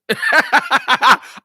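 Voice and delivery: bursting into a high real laugh. Real, not acted. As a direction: let a genuine laugh break out high. Laughing at absurd chat.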